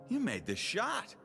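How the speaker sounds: high, drawn-out amazement; can't believe the kid did it; slow, rising disbelief; astonished. Acted. Astonished that the child made it.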